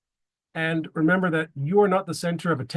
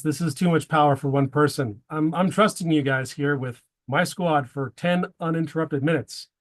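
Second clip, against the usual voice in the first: usual speaking voice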